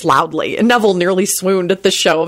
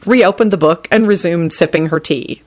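ordinary delivery

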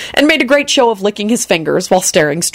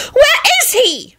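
very high, drawn-out angry screech; acted high-pitched fury; furious screech; slower than usual. A narrator's reading. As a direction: screech each word out slowly. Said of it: "she screeched furiously".

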